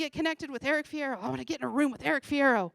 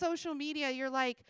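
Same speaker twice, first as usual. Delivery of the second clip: ordinary talk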